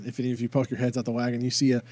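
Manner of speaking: ordinary talk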